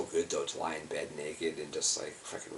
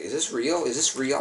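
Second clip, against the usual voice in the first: incredulous; 7 semitones higher, 6 dB louder than usual. Retold or imitated. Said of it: "I was like".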